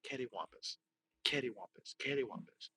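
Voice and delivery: very soft, testing the word; quiet mumbled repetition; muttering to self. Shown as a demonstration. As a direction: try the word out under your breath.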